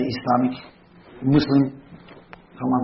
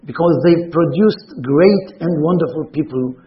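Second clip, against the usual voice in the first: higher, louder, declaiming the quoted text; formal reading; 6 semitones higher, 7 dB louder than usual. Acted. Rabbi reading a Spaniard's letter to his congregation, declaiming loudly. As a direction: read it like a proclamation to the congregation.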